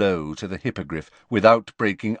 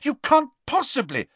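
high-pitched shocked protest. A narrator's reading. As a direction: protest in disbelief. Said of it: "sounding shocked".

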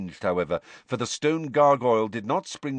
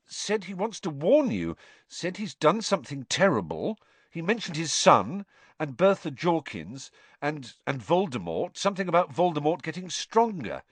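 higher-pitched, hurried recounting; urgent young hero, voice raised in pitch; Harry, anxious; 7 semitones higher than usual. A narrator's reading. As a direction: report what was said, groping for the details. Harry reporting to Dumbledore.

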